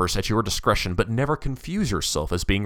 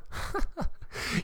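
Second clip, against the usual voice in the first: soft, high breathy chuckle; quiet scornful tittering; mocking laugh; 10 dB quieter than usual. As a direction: chuckle softly at the listener's ignorance. Dante laughs at the listener.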